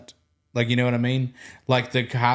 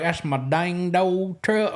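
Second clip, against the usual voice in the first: mock thick country accent, slow and raised; drawn-out, put-on country accent; cranked-up country accent; 4 semitones higher, slower than usual. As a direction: imitate guys laying on a Southern country twang. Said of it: "they would just crank that accent up".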